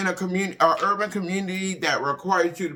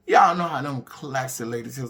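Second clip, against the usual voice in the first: ordinary delivery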